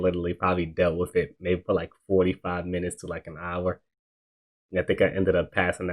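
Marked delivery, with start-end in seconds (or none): none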